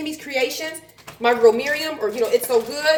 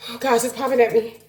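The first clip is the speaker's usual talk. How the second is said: ordinary talk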